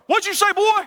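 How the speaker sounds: put-on granny's sharp high challenge, rising on "boy"; a high, scolding granny calling out a cheeky boy; stern granny.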